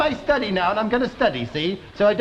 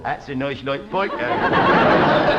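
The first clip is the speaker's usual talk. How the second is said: "That's a nice light pike handle" pitched much lower and slow, laughing; overdone posh; 10 semitones lower than usual. Acted. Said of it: "The king doesn't talk like that".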